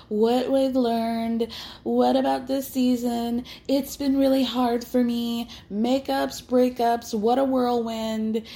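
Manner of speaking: hammed-up sentimental cast member; mock finale reflection. Host parodying the cast's end-of-season speeches, mock earnest.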